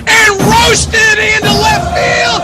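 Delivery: radio announcer screaming over a go-ahead home run; excited home run call. Real, not acted. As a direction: let it explode as the ball leaves the park, yelling with pure joy.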